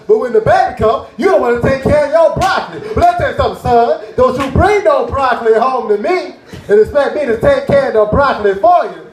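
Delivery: hammed-up father scolding; imitating his lecturing dad. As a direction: lay down the law to your son at dinner. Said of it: "My dad looked at my plate and said".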